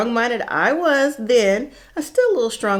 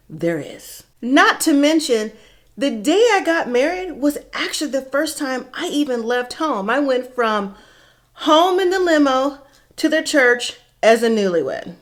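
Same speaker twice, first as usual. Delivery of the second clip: ordinary talk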